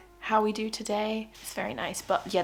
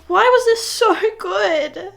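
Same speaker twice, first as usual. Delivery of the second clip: overwhelmed wail; 11 semitones higher, 10 dB louder, slower than usual. Real, not acted. Overwhelmed by a great book.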